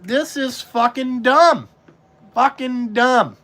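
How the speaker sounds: telling the other haulers at the salt meeting, slow and emphatic; slow emphatic disgust. Retold or imitated. Spell out how dumb it is, word by word. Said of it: "I'm like".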